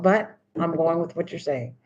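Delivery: usual speaking voice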